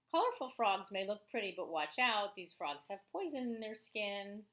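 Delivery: teacher reading a nonfiction book to her class, lively; reading aloud to children. Acted. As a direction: read the book to the kids with energy. Reads the frog book aloud.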